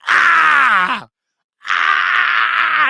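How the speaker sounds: high, loud anguished yells; retold screaming. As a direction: scream as the shot drops in. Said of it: "I start screaming".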